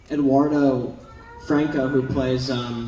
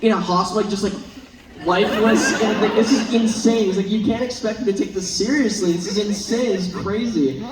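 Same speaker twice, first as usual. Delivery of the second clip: higher, insistent mock protest; raised-pitch, worked-up retelling; imitating exasperated castmate; 6 semitones higher than usual. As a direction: act out a co-star protesting the absurdity. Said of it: "And Eduardo was like".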